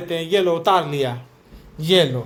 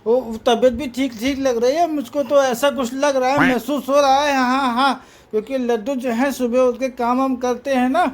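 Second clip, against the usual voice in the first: higher, sing-song voice for the babies; high playful voice, chatting to the babies; baby talk; 4 semitones higher than usual. Acted. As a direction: coo and tease the little ones, bright and lifted.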